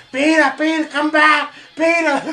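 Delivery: high, loud imitation of a frantic call; calling after someone. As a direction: call out as a mom chasing him. Acting out mom calling after Peter.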